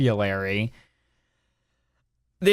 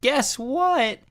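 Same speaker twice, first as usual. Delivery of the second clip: higher, heated rant; 9 semitones higher than usual. Real, not acted. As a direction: push the pitch up in exasperation. Ranting against elitism.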